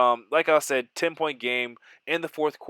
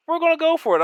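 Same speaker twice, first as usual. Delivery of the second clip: much higher, louder, pumped up; excited; 6 dB louder, faster than usual. Real, not acted. Gamer deciding to go for fourth down, excited. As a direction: announce the gamble with a thrill.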